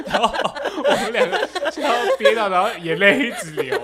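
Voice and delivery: high, loud, laughing through the words; words squeezed out between laughs. Real, not acted. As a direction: still giggling at the memory. Recalling laughing fit.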